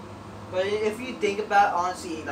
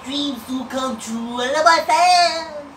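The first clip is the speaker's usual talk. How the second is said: slow, drawn-out, louder gushing; mock sappy wrestler; 4 semitones higher, 7 dB louder, slower than usual. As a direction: ham up a sentimental babyface.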